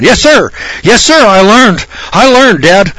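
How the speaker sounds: put-on high, loud, pleading child's voice; hammed-up scared son; frightened kid. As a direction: beg like a terrified boy after a beating. Beaten kid pleading to father.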